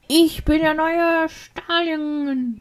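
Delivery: put-on high, drawn-out drunken proclamation; drunk shouting man. Storyteller mimics a drunk stranger on the street, drunken yelling. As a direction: imitate a drunk announcing himself, slow and high. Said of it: "er hat mir übelst ins Ohr geschrien".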